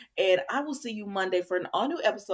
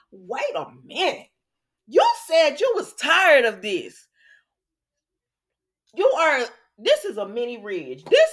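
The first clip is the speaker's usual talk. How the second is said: scolding; 3 semitones higher, 6 dB louder than usual. Real, not acted. Scolding Liam on soap recap.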